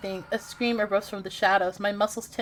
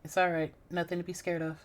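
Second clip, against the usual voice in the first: low reassuring man; 4 semitones lower, 8 dB quieter than usual. Acted. Male character reassures.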